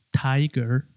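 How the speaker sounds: Tiger with a clear American R at the end. Shown as a demonstration. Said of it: "se escucha la R bastante claro".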